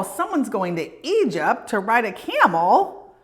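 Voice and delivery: plain reading voice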